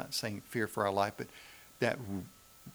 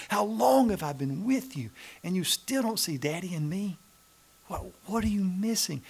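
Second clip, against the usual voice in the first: higher, louder, aching plea; groaning lament; 8 semitones higher, 3 dB louder than usual. Retold or imitated. Jesus pleading with disciples who still miss him, aching. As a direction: let the question break with longing. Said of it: "Jesus groaned in his spirit and said".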